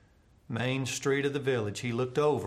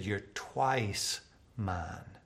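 slow tender vow; 3 semitones lower, slower than usual. A narrator's reading. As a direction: savour every word like a promise. Boy hugs his regained kite.

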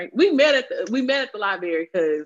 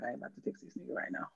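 quick, hushed mutter to self; muttered aside; 6 semitones lower, 19 dB quieter, faster than usual. Retold or imitated. Woman brushing off his text after work, muttered aside. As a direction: say it fast under your breath, much lower.

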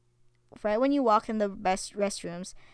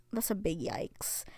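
whispering; 9 dB quieter than usual. Real, not acted. Disgusted whispered comment.

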